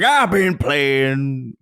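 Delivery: very slow, stretched-out Southern drawl; exaggerated Southern accent. Co-host imagining an all-Southern gaming podcast, thick Southern drawl. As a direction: ham up a Southern drawl, dragging every word. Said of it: "Southerners, but to, like, to the degree".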